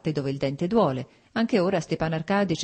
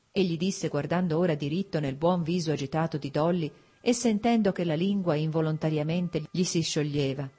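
usual speaking voice

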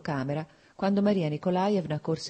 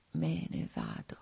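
slow, breathy whisper, much lower and softer; 8 dB quieter, slower than usual. A narrator's reading. Dying man whispers his end to Lievin, slow and faint. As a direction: barely breathe the words out.

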